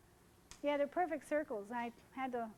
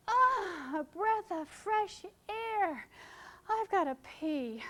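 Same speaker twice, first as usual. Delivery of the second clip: higher, slow, drawn-out sigh of relief; tipsy bride's relief; 6 semitones higher, 4 dB louder, much slower than usual. Retold or imitated. A touch of tipsy bride, slow and relieved. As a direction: savor the fresh air like a tipsy bride. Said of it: "These are her exact words".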